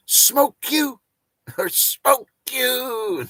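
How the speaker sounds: high, slow, drawn-out laughing threat; playful menace, high and stretched; laughing mock threat. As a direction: tease them with a mock threat through laughter.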